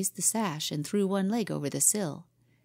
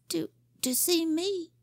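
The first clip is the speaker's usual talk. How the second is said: timid stammer; 11 semitones higher than usual. Acted. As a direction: stammer it out, caught and afraid. Scared boy stammers.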